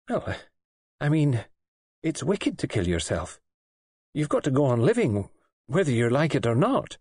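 fumble, then insist earnestly; earnest nurse. A narrator's reading. Nurse's voice in the story.

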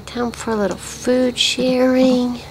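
slow sing-song said aloud; slow, drawn-out pet talk; slow voiced. Real, not acted. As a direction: stretch it out slowly in voice. Feeding the pet.

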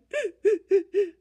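a high hammed-up blubbering sob; mock sob. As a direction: sob out loud like the bear. Bear sobbing sound.

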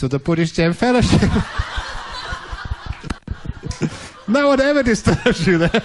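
punchline bubbling into laughter; laughing through punchline. Real, not acted. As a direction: chuckle your way through the joke. Punchline delivered amid laughter.

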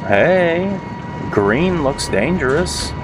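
high, drawn-out singsong; playful singsong. Real, not acted. Gamer noting the green looks dangerous, playful singsong. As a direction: lilt it up high, stretching the words.

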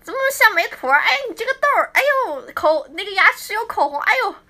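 high, loud teasing exclamations; teasing fans' voices. Retold or imitated. 模仿击掌时粉丝调侃她痘痘的高亢语气. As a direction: mimic the fans gawking at her pimple. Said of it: "我听到的最多的一句话".

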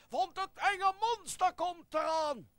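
loud, high storybook alarm; hammed-up monster warning; dramatic warning. Announces the scary monster's approach.

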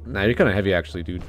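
ordinary talk

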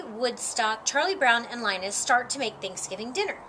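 ordinary delivery

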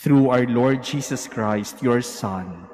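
loud, solemn intoned prayer; liturgical prayer. Acted. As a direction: proclaim it to the congregation. Priest intones collect.

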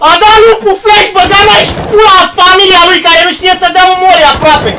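high, loud furious rant; raging shout. Real, not acted. Gamer raging at teammate who flashed him. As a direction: scream it out in real anger.

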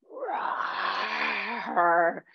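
roaring like a lion; big breathy roar. Shown as a demonstration. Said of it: "what sound do lions make".

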